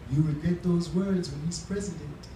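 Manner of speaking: put-on light West Indian accent on the elder's warning; lightly voiced West Indian elder, a touch ominous; a hint of a West Indian accent, retelling his prediction. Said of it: "I'm gonna do the West Indian accent".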